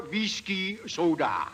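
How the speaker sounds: put-on slow, half-hearted French accent on whiskey soda; mock French. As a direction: copy the friend's French order to the waiter. Copies friend's French to the waiter.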